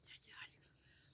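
very soft slow whisper. Real, not acted. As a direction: hush it, barely voiced. Repeats name in whisper. (шёпотом)

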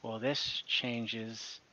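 the penny drops heavily; grave realization. Acted. Merchant realizes significance.

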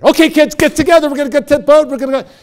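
put-on high, loud, frantic rallying of the kids; hyper dad, mosquito-like. Dad herding kids to the boat, hyperactive high-pitched shouting. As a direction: manic dad on overdrive. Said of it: "like a mosquito on cocaine".

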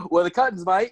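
put-on high, joking London accent; hammed-up London British; mock London accent. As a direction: imitate a Londoner, half laughing. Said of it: "It sound like y'all from London".

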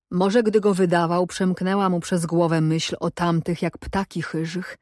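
plain reading voice

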